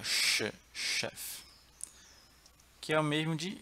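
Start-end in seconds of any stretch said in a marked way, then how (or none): 0.0-1.4 "ch" as a long "sh", then "chef"; sh sound alone. Shown as a demonstration. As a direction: isolate the hush of "ch" before saying "chef". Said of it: "é o som dela". ("ch" chiado, depois "chef")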